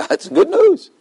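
high, delighted cry of good news; a jubilant high exclamation; excited exclamation. Acted. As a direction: squeal it out with joy.

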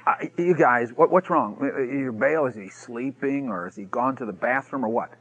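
low, soft mock concern. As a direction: needle them with understated taunts. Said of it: "And he mocked them".

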